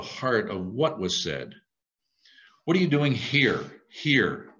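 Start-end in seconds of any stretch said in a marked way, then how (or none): 2.6-4.5 HERE stressed, then said alone once more; pointed question. Shown as a demonstration. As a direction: press on "here" as the heart of it. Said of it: "not so much how to say it".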